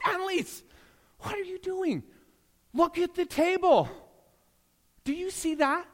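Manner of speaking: dad catching his daughter mid-scrape; exasperated dad. Retold or imitated. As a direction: call her out in disbelief. Dad catches daughter eating frosting.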